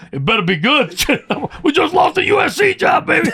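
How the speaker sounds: high half-laughing shout at his wife; retold outburst. Coach retelling what he told his ex-wife, high laughing shout. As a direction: blurt it out loud with a laugh. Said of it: "You know what I told her?".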